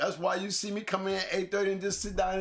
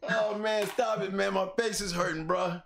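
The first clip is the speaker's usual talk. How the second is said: ordinary delivery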